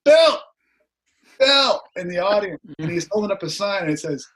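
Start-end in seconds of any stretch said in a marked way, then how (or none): 0.0-1.8 yelled from the crowd, much higher and louder; shouting a name. Retold or imitated. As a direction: call out over a concert crowd. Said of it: "this guy going".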